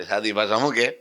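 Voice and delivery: ordinary delivery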